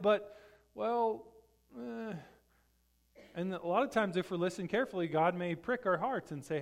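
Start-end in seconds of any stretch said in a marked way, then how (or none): 0.0-2.1 higher, hedging hesitation, trailing into a grunt; mock reluctance. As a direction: waver as if wriggling out of it. Acting out reluctance to answer God.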